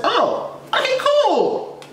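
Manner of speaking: very high, slow, fake-cool voice; a strained, high "okay, cool" that isn't cool; high mock-casual squeak. Retold reaction to the nurse.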